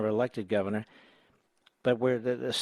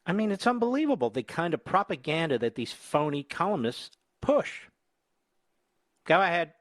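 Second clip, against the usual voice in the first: high, indignant tirade at the clip; raised, scornful voice at the screen; disgusted outburst; 5 semitones higher than usual. Real, not acted. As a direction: spit out the insult, disgusted. Host berates columnist clip.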